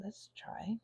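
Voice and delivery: soft whispered try. Real, not acted. Mixing paint, murmuring to herself in a whisper. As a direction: breathe it to yourself. Trying a colour mix.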